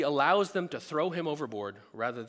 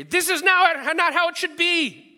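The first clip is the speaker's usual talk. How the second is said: loud, higher, indignant shout; furious outburst; 13 dB louder than usual. Retold or imitated. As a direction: bellow it at the driver who cut you off. Angry at driver cutting off.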